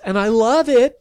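sudden high, loud reassurance; rushed, high and loud; hasty add. Acted. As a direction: blurt it out quickly to soften the blow. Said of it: "she hastened to add".

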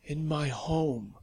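slow, husky invitation; husky and unhurried. Acted. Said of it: "Lavinia's husky voice called".

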